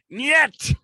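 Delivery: high, loud mock Russian accent; a curt Russian no in a hammed-up Russian accent. Still imitating the Russian handler in a mock Russian accent. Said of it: "my best Russian accent".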